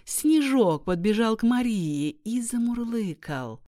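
ordinary delivery

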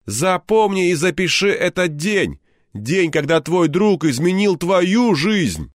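a buddy making a solemn pronouncement; friend Anton. A narrator's reading. Anton voiced, high and slow.

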